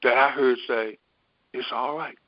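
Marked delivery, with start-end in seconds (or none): 1.5-2.2 soft imitation of an inner voice; hushed, lightly voiced reassurance; retold little whisper. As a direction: drop the volume, a small quiet voice. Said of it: "whisper, that same little voice".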